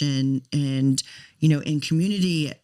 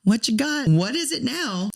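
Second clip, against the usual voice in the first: pitched up high, worn-out and pleading; exasperated plea to God; 9 semitones higher than usual. Retold or imitated. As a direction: throw the question up at God, fed up. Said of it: "And I just was like".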